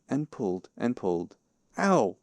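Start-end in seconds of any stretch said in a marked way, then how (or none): 1.8-2.2 high, louder yelp; pained cry. A narrator's reading. Pooh being pulled by the paw, pained cry. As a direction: yelp it suddenly. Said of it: "cried Pooh".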